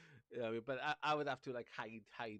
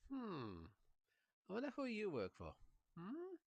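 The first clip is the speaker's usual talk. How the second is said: suspicious musing; 10 semitones higher, 4 dB quieter than usual. Retold or imitated. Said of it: "I tend to go".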